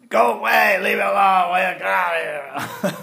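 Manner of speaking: high, loud cranky shout, last words dragged out; grumpy old man. Retold or imitated. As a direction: chase them off like a grouchy old man. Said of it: "grumpy old men".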